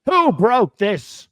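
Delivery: loud, high, slow mock demand; hammed-up priest's accusation; outraged priest. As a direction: draw out each word in theatrical indignation. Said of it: "the priest looks at her and says".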